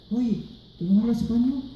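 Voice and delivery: high, softened grandma voice; questioning abuela. Retold or imitated. Grandma questioning her English-speaking grandchild, high puzzled voice. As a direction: lift the pitch in puzzled questioning. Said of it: "She said".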